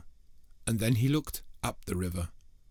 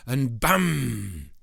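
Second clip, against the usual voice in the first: loud bam; 3 semitones higher, 7 dB louder than usual. A narrator's reading. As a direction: punch the bam louder. Goat charges the troll.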